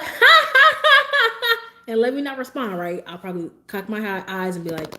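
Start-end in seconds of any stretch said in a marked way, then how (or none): 0.0-1.6 loud, high hammed-up ha ha ha; imitating fake laugh. Streamer acts out boss's fake laugh, loud and high. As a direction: belt out a fake laugh to be asked why. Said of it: "he'll be like".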